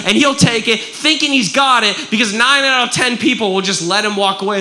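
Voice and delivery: normal talking voice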